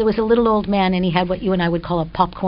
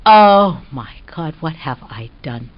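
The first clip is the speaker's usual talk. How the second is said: horrified realisation; 4 semitones lower, 4 dB louder than usual. Retold or imitated. Sudden realisation of shameful act.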